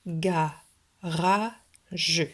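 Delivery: "ga, ra, je" in three separate beats; each syllable of "ga, ra, je" on its own; split into syllables. Shown as a demonstration. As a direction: dictate "ga, ra, je" with short pauses. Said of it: "le couper en trois syllabes".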